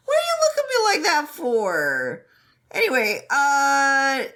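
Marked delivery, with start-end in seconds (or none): 0.1-2.2 high mock indignation. Voicing Wei Wuxian.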